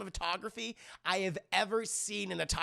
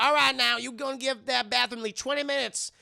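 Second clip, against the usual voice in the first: higher, loud comic warning; Medea; 5 semitones higher, 5 dB louder than usual. Retold or imitated. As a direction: announce it high and loud to everyone. Imitating Medea's bathroom warning.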